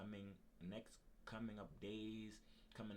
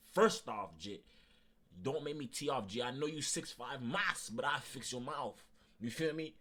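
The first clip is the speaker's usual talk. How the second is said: loud, higher, menacing warning; angry threat; 6 semitones higher, 13 dB louder than usual. Retold or imitated. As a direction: tell him off before you lose it. Threatening a coworker who mocks.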